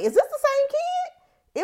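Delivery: very high, slow disbelieving question; incredulous. Retold or imitated. Viewer stunned by Aemond's turnaround, high-pitched disbelief. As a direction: squeak it out in mock disbelief.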